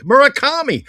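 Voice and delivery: ordinary talk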